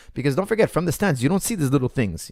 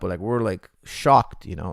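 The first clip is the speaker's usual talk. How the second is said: ordinary talk, nothing marked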